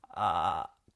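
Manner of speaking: 低いかすれたエッジボイス; 声門をぷつぷつ鳴らす低い「あー」; エッジボイス. Shown as a demonstration. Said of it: "この音をですね、入れてるんですよ". (エッジボイスの実演)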